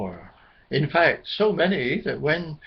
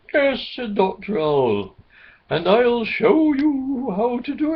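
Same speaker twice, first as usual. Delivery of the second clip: Doctor Owl voice; 7 semitones higher, 4 dB louder than usual. Acted.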